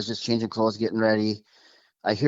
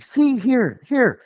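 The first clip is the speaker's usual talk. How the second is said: high, louder imitation of her urging; insistent retold quote; 14 semitones higher, 4 dB louder, much slower than usual. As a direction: mimic her pointing it out, pitched way up. Retold girlfriend pointing at her video.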